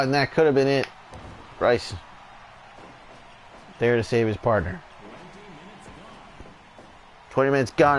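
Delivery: steady wrestling commentator call; live play-by-play. Acted. Commentator calling the tag match for viewers, play-by-play. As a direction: call each move as it lands.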